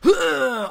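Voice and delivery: short, high retching noise; hammed-up puke sound; imitated vomiting. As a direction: mimic a sudden loud heave. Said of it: "just started going".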